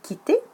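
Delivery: quitter said high and loud; echo the word. Shown as a demonstration. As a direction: throw back quitter high and loud, like a question. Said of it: "répéter le mot".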